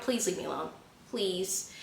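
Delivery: very soft, restrained plea; strained calm. Retold or imitated. Hurt walker to the rider who follows, hushed plea. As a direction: beg quietly, holding the temper in. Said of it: "I'm trying to be calm".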